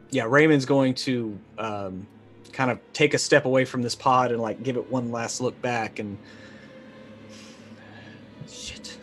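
ordinary talk, nothing marked

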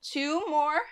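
normal talking voice

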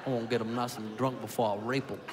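low sly ordering voice. Retold or imitated. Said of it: "And he goes".

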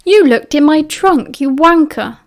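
outraged accusation. A narrator's reading. Remus accuses Sirius of snooping in his trunk, outraged.

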